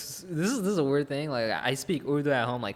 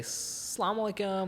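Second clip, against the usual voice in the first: put-on high, slow American-accented "Salaam alaikum", vowels stretched; Americanized "Salaam alaikum", said slow and high as a playful imitation; 7 semitones higher, 7 dB quieter, slower than usual.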